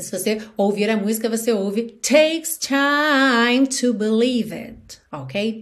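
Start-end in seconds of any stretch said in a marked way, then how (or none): 2.0-5.0 slow, with "time" held long; song rhythm. Shown as a demonstration. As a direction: recite "takes time" slowly as in the song. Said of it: "o it realmente não aparece".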